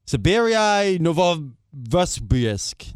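slow, struggling foreign name; stumbling through a long Russian name; slow sounding out. Real, not acted. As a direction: drag out every syllable.

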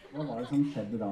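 usual speaking voice

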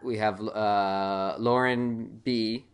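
very slow, stretched-out reveal; slow dramatic reveal. Acted. Host to co-hosts, stretching the reveal of a favorite, slow and dramatic. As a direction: drag it out like a big announcement.

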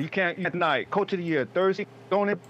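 usual speaking voice

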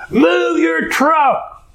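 high, drawn-out mock yell; retold yelling. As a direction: yell it slowly like the irate driver being imitated. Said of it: "She starts yelling at him".

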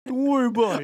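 put-on New York accent. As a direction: toss it off like a Queens local with a New York accent. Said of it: "a thick New Yorker accent".

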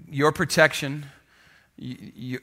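ordinary delivery

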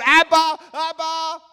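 high, loud frightened cry; a scared little boy calling his father; child crying out. Retold or imitated. Frightened boy on a ledge calling his father. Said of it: "he shouted out".

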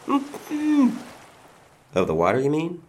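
high, slow, sing-song mock voice; mocking taunt. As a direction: stretch it out high to taunt the captive. Villain mocks taped captive.

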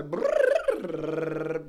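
loud, sustained lip-trill warm-up. Shown as a demonstration. Auctioneer showing a pre-auction warm-up, loud trill. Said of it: "I trill".

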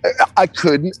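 ordinary talk

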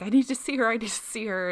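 new mother urgently begging to see her daughter; urgent plea. Retold or imitated. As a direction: beg to see the baby, insistent. Mother pleading after birth.

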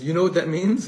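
a Rebbe bursting with an insight; excited question. Retold or imitated.

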